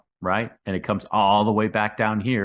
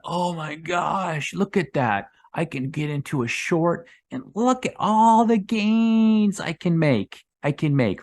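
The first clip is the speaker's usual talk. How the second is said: much higher, hammed-up giddy gasp; mock excitement; 9 semitones higher, slower than usual. Mocking emotional traders who see the chart, higher, hammed-up glee. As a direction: play a greedy follower drooling over gains.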